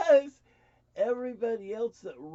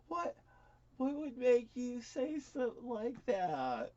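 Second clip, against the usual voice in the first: higher, slow disbelief; drawn-out, baffled question in a raised voice; incredulous; 7 semitones higher than usual. Retold or imitated. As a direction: ask it high and slowly, taken aback. Recalls reacting to sister's accusation.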